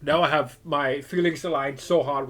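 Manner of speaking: loud, eager hype with a laugh; real excitement. Real, not acted. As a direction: shout it out, thrilled by the scene. Streamer reacts to game scene.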